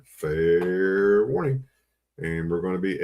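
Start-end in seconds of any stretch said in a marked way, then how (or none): 0.0-1.6 very slow, stretched out; slow drawn warning. Real, not acted. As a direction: drag each word out for effect.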